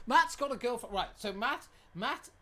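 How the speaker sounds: teasing. Real, not acted. Teasing Matt loudly.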